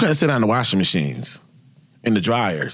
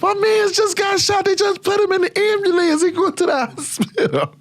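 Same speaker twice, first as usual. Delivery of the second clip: laughing shout. Retold or imitated. Teen self laughing that a man got shot, high laughing shout. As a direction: howl it through laughter in a high put-on voice.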